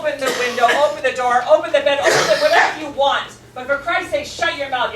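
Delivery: loud, high exasperated shouting at him; fed-up shouting rant; exasperated yell. Acted. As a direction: blow up at the nagging roommate. Exasperated roommate yelling back.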